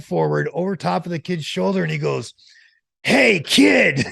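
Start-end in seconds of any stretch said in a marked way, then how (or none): 3.0-4.1 loud, high-pitched imitation of a yell; retold yell. Friend startling a kid in the elevator, imitated yell. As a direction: holler it out as the friend did. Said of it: "when he heard the yelling".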